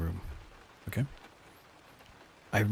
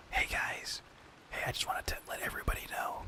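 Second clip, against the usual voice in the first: unvoiced whispered manager pep talk; 7 dB quieter than usual. Shown as a demonstration. Whispering the manager's greeting to the team. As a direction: breathe it out as a secret whisper. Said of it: "should I whisper it?".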